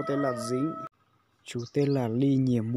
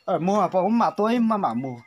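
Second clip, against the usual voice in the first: to someone hard of hearing; 6 semitones higher, 8 dB louder than usual. Real, not acted. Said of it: "phải nói to to bà cụ mới nghe được".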